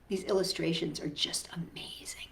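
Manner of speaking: soft whisper; whispered aside. Real, not acted. Teacher whispering to the kids about the pictures. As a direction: breathe it in awe.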